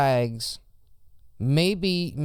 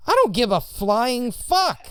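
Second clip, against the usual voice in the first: heated, raised defiant voice; angry outburst; 8 semitones higher, 4 dB louder than usual. Real, not acted. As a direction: snap back at the haters. Defiant rant at channel haters.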